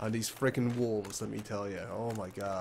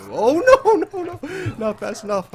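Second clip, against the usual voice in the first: high, loud frightened cry; panicked scream; 12 dB louder than usual. Real, not acted. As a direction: shriek as it catches you. Wolf catches him.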